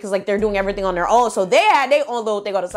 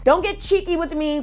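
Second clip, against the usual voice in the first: usual speaking voice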